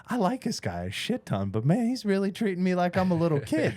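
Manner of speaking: half laughing at being babied; mock indignant inner thought; inner complaint. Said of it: "sitting there going".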